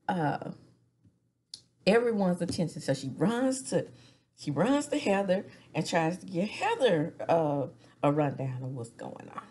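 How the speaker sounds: ordinary talk, nothing marked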